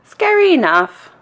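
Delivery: high-pitched note of success; triumphant boy. A narrator's reading. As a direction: say it high, satisfied the scare worked. Boy pleased with his scare.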